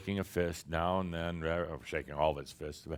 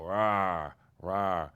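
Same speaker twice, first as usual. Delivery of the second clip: drawn-out "rah" growl, louder; mock spider roar; 4 dB louder than usual. Spider putting on a fight for the human, mock roar.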